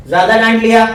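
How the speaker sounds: scolding parent. Retold or imitated.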